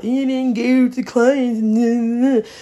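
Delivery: high, drawn-out mocking imitation; mock workplace scolding. Job criticism of not engaging with clients, mocking imitation. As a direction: stretch it out high, mimicking a nagging supervisor. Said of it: "what they say at my job".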